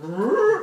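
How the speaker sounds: loud, high imitated bull cry; cartoonish animal roar; bull bellow. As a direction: bellow like a bull poking its head out. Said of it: "the bull puts his head".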